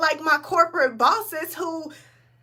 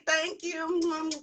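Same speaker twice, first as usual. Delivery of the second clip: ordinary talk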